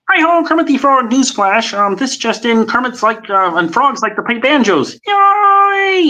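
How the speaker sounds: very high, excited frog voice ending in a squeal; Kermit the Frog. Shown as a demonstration. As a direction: bounce it high and eager like Kermit. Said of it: "All right, Kermit".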